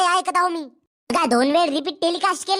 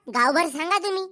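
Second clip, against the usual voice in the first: normal talking voice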